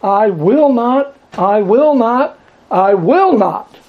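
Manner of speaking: "I will not" slow and high, each time pressed; emphatic repetition. Shown as a demonstration. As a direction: spell it out slowly three times. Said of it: "the deep emphasis".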